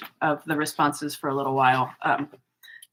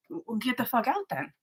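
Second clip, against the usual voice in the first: rising exasperated comeback, much higher by the end; imagined retort; 6 semitones higher than usual. Retold or imitated. A vlogger tells off the trolls in her head, exasperated and ever higher. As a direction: tell them off, climbing higher and higher. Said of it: "And I'm like".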